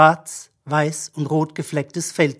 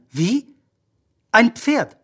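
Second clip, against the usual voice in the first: higher, questioning surprise; seemingly astonished; 6 semitones higher, slower than usual. A narrator's reading. Magician hears the girl's guess, acting astonished. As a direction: question it in mild disbelief. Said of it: "Er schien verwundert zu sein".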